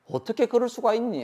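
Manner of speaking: shocked reproach. Acted. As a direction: cry out the betrayal. Family member's reproach acted out.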